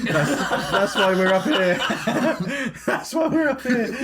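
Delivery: high laughing voice. Real, not acted. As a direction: giggle through the words. Laughing through the line.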